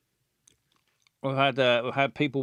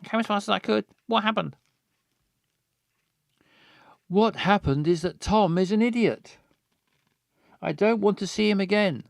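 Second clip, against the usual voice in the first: upset; 6 semitones higher, faster than usual. Acted. Voicing upset friend.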